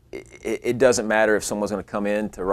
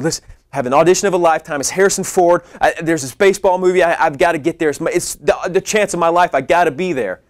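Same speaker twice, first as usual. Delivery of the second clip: higher, louder and rushed, breathless with panic; frantic pleading; 8 semitones higher, 5 dB louder, faster than usual. Retold or imitated. Begging a car dealer to break into his car, frantic and rushed. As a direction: blurt it out like your whole career depends on it.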